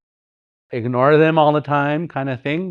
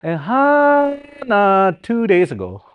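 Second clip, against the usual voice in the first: imitating uncle; 7 semitones higher, 3 dB louder, slower than usual. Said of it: "one uncle said".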